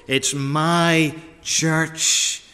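"my" drawn out very slowly and underlined; slow with "my" stretched and stressed. Acted. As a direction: linger on "my" as if underlining it. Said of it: "as if he underlines it".

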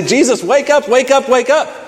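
high pitched urgent pleading; urgent wake-up call. Acted. Frightened disciples rousing sleeping Jesus, high urgent plea. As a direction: shake him awake with your voice. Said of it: "wake him up and say".

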